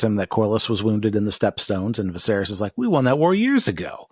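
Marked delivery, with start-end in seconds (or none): 2.8-4.1 pitched-up quoted objection; retold protest. Host as Viserys answering Daemon, lightly acted protest. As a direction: lightly voice a puzzled king objecting. Acting out king's surprised objection.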